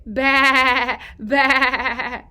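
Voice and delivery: loud playful baa; sheep bleat. Shown as a demonstration. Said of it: "What does the sheep say?".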